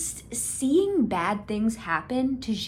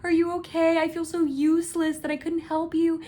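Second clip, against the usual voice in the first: higher, frantic, worried voice; mock freaking out; 8 semitones higher, faster than usual. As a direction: gush it out in a panic. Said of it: "he's, like, freaking out".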